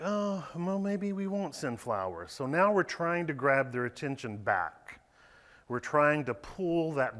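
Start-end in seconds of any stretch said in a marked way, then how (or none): 0.0-2.3 higher-pitched mock offhand customers; imitating fickle customers. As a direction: lightly voice customers shrugging flowers off. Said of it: "they were like".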